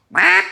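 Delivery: high loud honk; duck call honks. Shown as a demonstration. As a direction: blast a quack. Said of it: "I'll hit it with a double reed".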